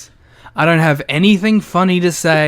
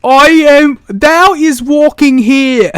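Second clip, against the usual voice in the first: much higher, louder, slow hammed-up archaic voice; medieval New Yorker; 8 semitones higher, 8 dB louder, much slower than usual. Mocking the wrestler's accent as old-fashioned speech, loud and slow. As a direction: ham up a mock olde-English New Yorker. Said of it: "mystical character".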